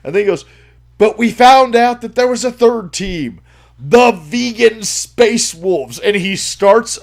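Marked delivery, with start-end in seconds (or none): 1.0-6.0 ranting announcement. Retold or imitated. Retelling the ranting man's announcement.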